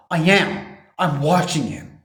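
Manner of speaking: loud, high retold protest; mock arguing. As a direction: snap back like a defensive husband, acted out. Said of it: "arguing with his wife".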